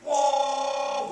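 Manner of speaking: a sudden high shout of "Whoa!"; surprised whoa. Retold or imitated. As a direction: call out in relief as the boat appears. Camera boat arrives, he's waving.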